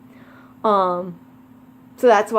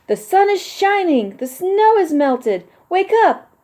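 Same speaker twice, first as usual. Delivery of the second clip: calling out; 11 semitones higher, 4 dB louder than usual. Acted. Frog keeps calling Toad.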